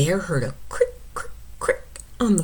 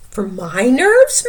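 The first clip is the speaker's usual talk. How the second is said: high, slow puzzled question; surprised mouse; 7 semitones higher, 8 dB louder than usual. Acted. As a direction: echo it in surprise.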